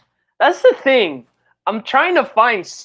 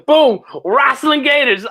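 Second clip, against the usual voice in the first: excited; 7 semitones higher, 4 dB louder than usual. Real, not acted. Excited pitch of a comic idea.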